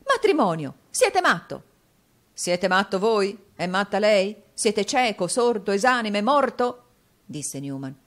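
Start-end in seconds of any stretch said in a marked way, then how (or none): 0.1-1.6 loud, high cry of disbelief; shocked outburst. A narrator's reading. Nicola hearing of a marriage, shocked outburst. 2.3-6.8 loud volley of indignant questions, climbing; heated retort. A narrator's reading. Newman throwing the accusation back at Nicola, heated retort.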